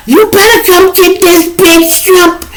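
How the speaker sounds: very high, slow, shrill mock wail; mock crying baby mama. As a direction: whine it like a hysterical mother on the phone. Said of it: "Her mama call me all the time crying".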